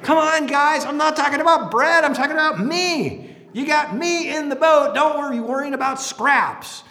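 pleading Jesus. Retold or imitated.

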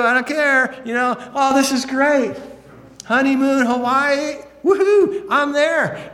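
over-the-top honeymoon bragging, high and loud; hammed-up gleeful groom. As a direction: crow like a giddy newlywed taunting his buddies. Voicing a gleeful newlywed groom.

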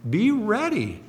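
hammed-up warning cry; mock alarm. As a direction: throw up your hands at the forecast. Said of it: "we're like".

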